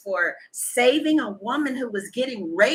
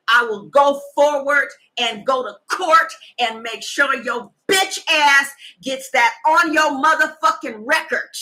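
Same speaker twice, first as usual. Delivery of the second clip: loud, forceful warning; threatening; 5 dB louder than usual. Retold or imitated. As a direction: lay down the law, hammering every threat. Retold threat to her man.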